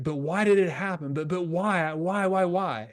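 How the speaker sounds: mock pleading, piling up the whys; insistent questioning. Players asking why it happened, mock insistent. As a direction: imitate someone stuck on why, pitch climbing.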